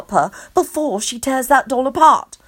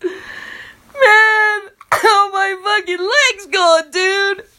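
high, loud, crying wail; Kenny wailing over his lost leg; wailing like a toddler; 10 semitones higher, 4 dB louder, slower than usual. A narrator's reading. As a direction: bawl like a two-year-old. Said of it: "starts wailing like a two-year-old".

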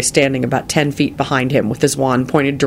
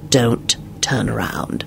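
slow urgent whisper; hushed, drawn-out hissed warning; hissed in ear; 3 semitones lower, 5 dB quieter, slower than usual. A narrator's reading. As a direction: hiss a warning into her ear. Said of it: "he hissed in her ear".

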